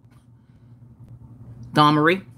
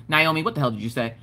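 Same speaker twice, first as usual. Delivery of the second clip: ordinary delivery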